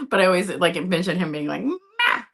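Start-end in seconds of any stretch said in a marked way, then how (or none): 1.6-2.2 high, loud yowling "Ma!" like a cat; cat yelling for its mom; yelling cat impression. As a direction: mimic the cat calling for mom, much higher. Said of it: "Like he's yelling at me".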